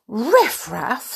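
high, loud, shocked question; indignant cry. A narrator's reading. Princess defends her friends to the guard, crying out. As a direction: throw the word back in disbelief.

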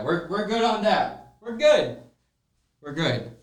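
high sing-song to himself; relieved. Real, not acted. Host checking the camera sound, high relieved sing-song. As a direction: lilt it up, muttering relief.

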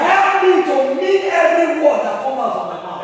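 leading the church in shouted prayer; loud fervent prayer. Real, not acted. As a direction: call out the prayer at full force. Leads congregation in prayer.

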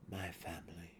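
soft, harsh whispered realization. A narrator's reading. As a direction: breathe it out harshly. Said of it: "he whispered harshly".

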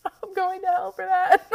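crying and laughing at once; tearful laugh at her own joke; laughing through tears. Real, not acted. Dark joke amid tears.

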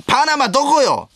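high, loud drawn-out cry; shrill questioning shout up high; comic high yell. Acted. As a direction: yell it like a lost traveller. Reading a comic last-words answer.

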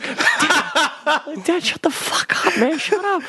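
high breathy hushing plea through laughter; embarrassed son. Retold or imitated. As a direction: shush your dad in a panic. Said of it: "I was like".

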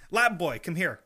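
imperious doctor ordering an assistant; mad scientist. Acted. Doctor to lab assistant, hammed-up mad scientist command.

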